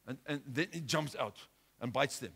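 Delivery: ordinary talk, nothing marked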